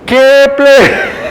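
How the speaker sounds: high, loud hail of Kepler; corrected call. Shown as a demonstration. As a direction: call out Kepler with a rising tune. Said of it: "Borran ahí".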